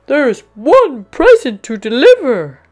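loud, high, alarmed discovery; Santa Claus. A narrator's reading. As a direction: exclaim it in booming surprise. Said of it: "Santa gasps".

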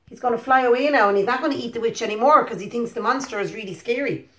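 ordinary delivery, nothing marked

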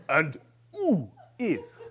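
put-on slow, drawn-out French accent; hammed-up French facilitator. Facilitator asking about another man, exaggerated French accent. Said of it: "'Cause he's French".

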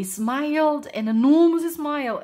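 ordinary delivery, nothing marked

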